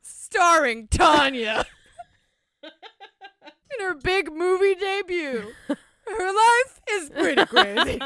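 high, drawn-out mock announcer through laughter; laughing trailer parody. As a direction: slowly announce the star while cracking up. Joins trailer parody while laughing.

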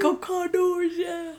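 high-pitched drawn-out cheer; mock playoff cheering; hyped fan imitation. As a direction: echo the fan's whoop, high and stretched. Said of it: "is super hyped at the playoff game".

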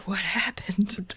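slow, breath-only whisper; acted hushed bewilderment; dazed whisper. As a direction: whisper it as if just coming to. Waking dazed after the brain switch.

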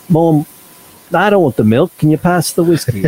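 half-joking British accent playing a kid at the table; fake British accent. Joking British accent as a kid asking Mom for whiskey. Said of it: "a little fake British accent".

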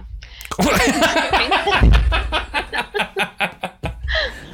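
high burst of real laughter; big startled laugh. Real, not acted. Laughs at host's teasing.

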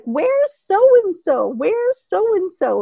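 put-on high, slow old-lady calling; drawn-out searching old voice; imitating grandma. Grandma looking for a relative on screen, slow high old voice. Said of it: "And she's like".

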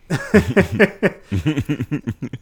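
bright rising laugh. Real, not acted. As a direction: laugh it out loud. Laugh after shout out.

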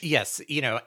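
ordinary talk, nothing marked

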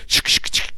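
imitated cocktail shaker rattle; unvoiced shk-shk of a shaker; shaker noise. Host mimicking the villain shaking a drink, shaker noise. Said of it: "it sounds like he's really making a drink".

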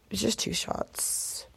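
slow soft whisper; whispered reassurance. Real, not acted. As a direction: hush it like a little secret. Downplaying drinking.

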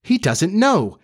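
high-pitched, scandalized outburst; shocked exclamation. A narrator's reading. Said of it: "so shocked that she exclaimed".

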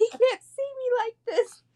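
high, teary imitation of crying; tearful mock wail. To herself, embarrassed at the wedding, a high teary wail. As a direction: squeak it out like you're fighting back tears. Crying in front of band director.

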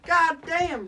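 normal talking voice

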